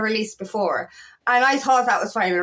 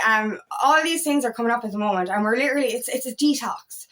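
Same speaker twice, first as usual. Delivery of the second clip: usual speaking voice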